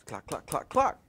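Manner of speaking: soft, low mimicked knocks; imitated knocking. Storyteller mimics knocks heard at the door, soft imitation. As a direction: tap out knocks with your voice, quietly.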